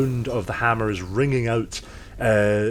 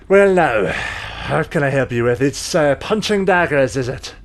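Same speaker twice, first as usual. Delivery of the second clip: much higher, louder dwarf woman voice; dwarven smith; 3 semitones higher, 6 dB louder than usual. Acted. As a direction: greet the customer as a loud dwarven smith. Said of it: "She walks over and goes".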